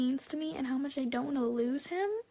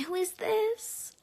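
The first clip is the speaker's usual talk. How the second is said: very high, slow, sarcastic mimicry; exaggerated high-pitched mock cluelessness; mocking imitation; 8 semitones higher, 3 dB louder, slower than usual. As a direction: squeal his question upward, dragging it out. Sarcastic repeat of his answer.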